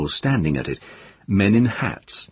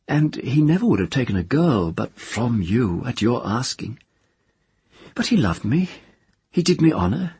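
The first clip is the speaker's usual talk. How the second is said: lightly raised woman's voice; earnest woman confiding, a bit higher; Tenar's voice; 8 semitones higher than usual. A narrator's reading. Tenar's voice in the story.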